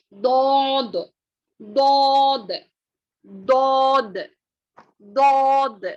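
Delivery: दौद said full-mouthed and loud with the lips not rounded; heavy daud, lips unrounded. Shown as a demonstration. Teacher correcting lip rounding on दौद. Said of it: "वो नहीं करना है".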